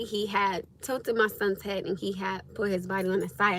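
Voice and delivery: normal talking voice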